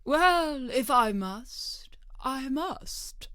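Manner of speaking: reluctant, slow sigh of a king; a weary king giving in; melancholy. A narrator's reading. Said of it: "with a melancholy air".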